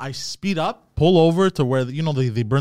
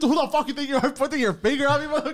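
retold yell; 11 semitones higher than usual. Driver chases the skater, mock angry yell. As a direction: taunt him to come back. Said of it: "I'm yelling at him".

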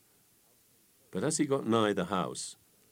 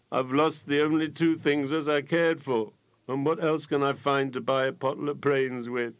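higher, louder tearful lament; weeping; 7 semitones higher, 4 dB louder than usual. A narrator's reading. Fool weeping by the roadside, higher and louder. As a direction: cry out the loss. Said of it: "sat down by the roadside and greets".

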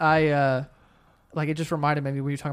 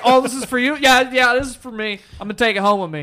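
mock excited; 5 semitones higher, 5 dB louder than usual. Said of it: "he's like".

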